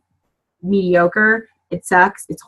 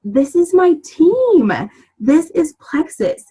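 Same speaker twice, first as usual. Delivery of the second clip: excited realization; 8 semitones higher, slower than usual. Retold or imitated. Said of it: "sitting in the pew going".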